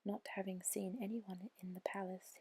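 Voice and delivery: usual speaking voice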